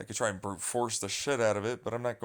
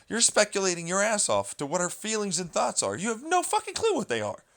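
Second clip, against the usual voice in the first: much higher, louder indignant rant; angry rant; 9 semitones higher, 4 dB louder than usual. Real, not acted. Telling off speculating commenters, rising fury. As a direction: let the anger climb.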